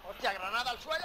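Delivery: loud urgent warning; grenade warning yell. Real, not acted. Grenade lands nearby.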